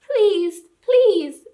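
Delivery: high, pleading little lamb. A narrator's reading. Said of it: "said the high voice of a woolly lamb".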